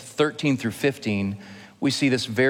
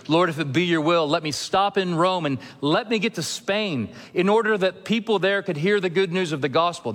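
higher, hurried plea to the Lord; earnest pleading prayer; 6 semitones higher than usual. Retold or imitated. Paul asking God to send him to Spain, earnest plea. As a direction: plead it urgently, pitched up.

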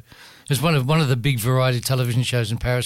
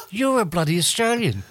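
laughing; 5 semitones higher than usual. Retold or imitated. Retold reply to the Australian waiter.

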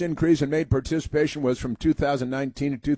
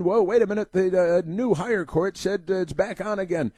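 mocking officials; 6 semitones higher than usual. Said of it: "And then".

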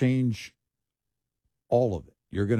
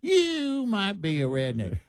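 much higher, slow comic-voiced joke line; Foxworthy punchline; 13 semitones higher, slower than usual. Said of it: "and Jeff Foxworthy".

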